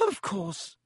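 soft, croaky and choked up; a touch of a voice catching with tears. Acted. McGonagall moved by Harry's story, soft croaky reply. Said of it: "in a strangely croaky voice".

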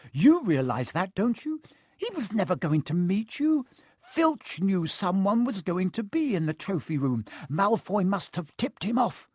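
high-pitched insistent telling-off; scolding. A narrator's reading. Hermione telling Harry that Malfoy tricked him, scolding.

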